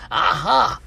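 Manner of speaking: high, loud mock "aha"; triumphant exclamation. Reading out his text to his niece, excited exclamation. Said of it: "and it went like this".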